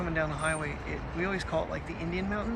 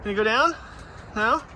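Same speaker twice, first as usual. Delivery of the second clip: coaxing pet talk; 5 semitones higher than usual. Real, not acted. Coaxing dog down the rocks.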